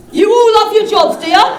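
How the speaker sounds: loud sarcastic crowd jab; crowd taunt. Real, not acted. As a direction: needle the audience loudly.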